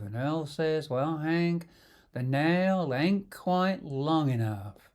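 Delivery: slow, stretched-out Southern US drawl; Southern American drawl. Acted. Telling Joe a story on a run, in a Southern American drawl. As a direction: tell it like an unhurried Southern yarn-spinner, imitation accent. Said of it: "I told it in a Southern American drawl".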